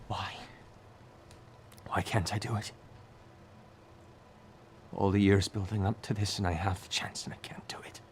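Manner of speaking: soft, broken whisper; trembling whisper. Acted. Daughter sobbing into the father's ear, whispering. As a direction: whisper it like she's falling apart. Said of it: "her voice trembling in your ear".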